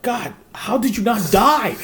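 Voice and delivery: retold mechanic, loud and high with disbelief; mechanic amazed. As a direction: play the tire guy who can't believe it. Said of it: "they're like".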